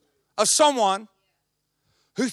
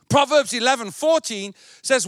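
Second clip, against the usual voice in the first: usual speaking voice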